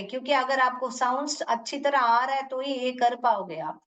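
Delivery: ordinary talk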